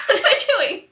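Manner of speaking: high, loud, laughing disbelief. Real, not acted. Friend cracking up at her own silly dare, laughing. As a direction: crack up loudly while asking. Cracks up during hickey contest.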